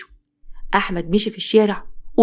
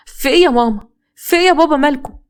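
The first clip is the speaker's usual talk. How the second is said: alarmed; 6 semitones higher, 4 dB louder than usual. Acted. Daughter woken by commotion.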